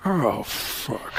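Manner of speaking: low, quiet, dismayed grumble; Al Pacino. Retold or imitated. Pacino spotting Alec in the elevator, quiet dismay. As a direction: grumble it under your breath, fed up. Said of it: "Pacino looked and went".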